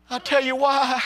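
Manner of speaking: high, voice breaking into a sob; tearful cry. Real, not acted. Preacher overcome telling the congregation, tearful high cry. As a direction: let the voice crack with feeling.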